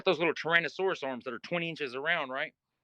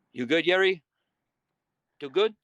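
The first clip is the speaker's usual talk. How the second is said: put-on Spanish accent; 3 semitones higher, 7 dB louder than usual. Spanish-speaking cellmate checking on Jerry, imitated Spanish accent. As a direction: mimic a Spanish speaker's English, Y for J. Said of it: "He don't speak no English".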